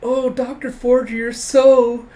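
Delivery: high, loud swooning gush; hammed-up admirer's squeal; fawning nurses. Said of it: "secretaries and nurses be like".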